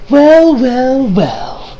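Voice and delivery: imitated taunting welcome; knowing villain greeting. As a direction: start high and let the last word sink. Voicing character appearing in background.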